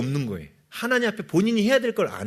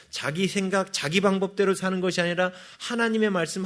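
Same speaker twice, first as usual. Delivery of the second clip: ordinary talk, nothing marked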